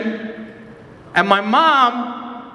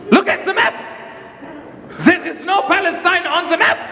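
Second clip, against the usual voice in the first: officer shouting; 8 semitones higher than usual. Retold or imitated. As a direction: yell it while pointing at the wall.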